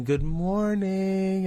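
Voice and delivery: long, high sing-song good morning; little niece's greeting. Retold or imitated. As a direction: imitate a little girl cooing a drawn-out wake-up call. Niece playing nurse wakes him up.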